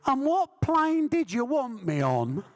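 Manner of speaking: high and slow, with a laugh; squeaky eager Joe Bugner. Retold or imitated. Bugner hearing a huge offer, high squeaky and slow. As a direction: squeak it out, suddenly keen. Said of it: "he had a very high-pitched voice".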